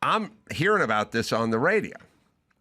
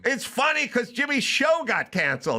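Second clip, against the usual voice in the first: high-pitched retold exasperation; exasperated retort; 12 semitones higher than usual. Comic spells out the cancellation to his clueless friend, exasperated.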